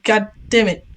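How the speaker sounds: normal talking voice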